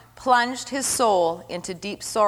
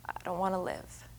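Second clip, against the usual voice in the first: put-on child's whisper; 4 semitones lower, 17 dB quieter than usual. Child voiced in story.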